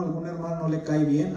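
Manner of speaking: ordinary delivery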